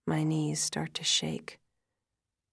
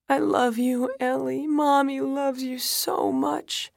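wailing; 9 semitones higher, 8 dB louder than usual. A narrator's reading. Widowed mother wailing love to her daughter. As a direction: pour out love through anguish. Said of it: "I start to wail".

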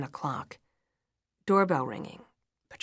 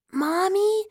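put-on high, loud rising child's call; small child; 9 dB louder than usual. A narrator's reading. Daughter interrupting mother's phone call, small child's voice. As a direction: call out as an alarmed little child.

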